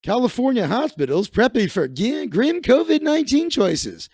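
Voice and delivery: headline read like a DJ; radio announcer. Shown as a demonstration. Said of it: "everything's more fun when you read it like a radio announcer".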